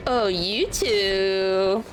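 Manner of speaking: ordinary talk, nothing marked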